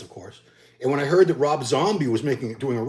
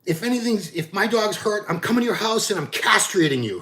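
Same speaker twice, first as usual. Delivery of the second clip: a hint of the furious dog owner; imitating the angry woman; 8 semitones higher than usual. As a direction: play the screaming neighbor lightly. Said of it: "her exact words".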